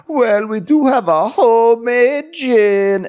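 high, loud, slow sing-out; stretched, theatrical waiter crowing; excited waiter spiel. Retold or imitated. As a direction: announce it with hammed-up excitement. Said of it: "he was all excited. He had a whole spiel".

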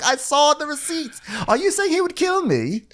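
high, indignant disbelief; mock incredulity. King defending his evil-dressed brother, incredulous. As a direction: insist on it, voice climbing high.

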